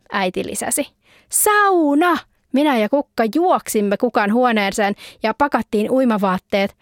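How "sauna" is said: excited. A narrator's reading. Girls cheer about the sauna.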